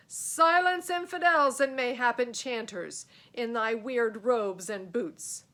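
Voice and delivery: loud, high roaring command; furious knight. Acted. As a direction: silence the infidels with a bellow.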